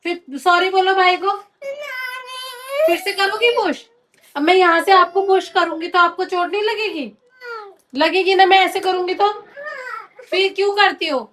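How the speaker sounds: mother scolding her daughter for pushing her brother. Real, not acted. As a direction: tell her off firmly.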